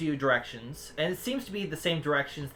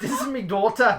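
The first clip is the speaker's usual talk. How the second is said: put-on muddled Jamaican-Irish accent, pitched up; louder, hammed-up mongrel Jamaican accent; 5 semitones higher, 6 dB louder than usual. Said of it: "didn't inherit the effed up accent".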